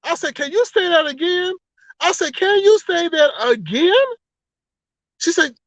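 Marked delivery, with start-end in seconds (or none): 0.3-1.5 much higher, incredulous ask; can't believe what she just heard; shocked disbelief. Retold or imitated. Asking Brianna to repeat the lie, incredulous and high. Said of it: "I was so shocked". 2.4-3.9 much higher, voice breaking with a cry; pleading, almost in tears; near-crying disbelief. Retold or imitated. Asking Brianna again, disbelief tipping into a cry. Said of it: "I was so shocked".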